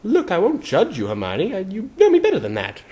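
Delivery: put-on high boyish voice, pleading gently; high young boy, mild pleading; pleading Harry. A narrator's reading. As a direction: beg her to trust you. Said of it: "Harry pleaded".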